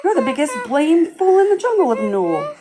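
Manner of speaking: high, squeaky little joey taunting; young kangaroo. A narrator's reading. Young kangaroo in the pouch mocks the elephant, high and squeaky. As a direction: pipe up like a cheeky baby kangaroo.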